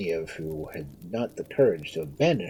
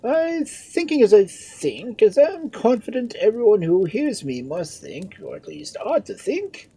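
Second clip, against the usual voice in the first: high, loud, self-important lordly voice; puffed-up peer holding forth; pompous lord; 6 dB louder than usual. A narrator's reading. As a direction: pontificate in a high, raised voice. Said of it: "said Lord Gustavus pompously".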